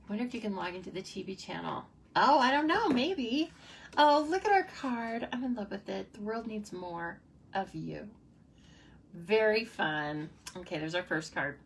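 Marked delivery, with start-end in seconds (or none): 2.2-6.1 high, loud, bubbly exclaim; higher and louder burst of delight. Real, not acted. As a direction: show off the card with glee. Delighted reaction to finished card.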